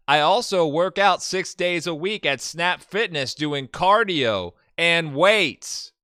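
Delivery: loud, hammed-up profile read; dramatic profile reading. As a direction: read it like a bold personal ad. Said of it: "maybe I should do a southern accent".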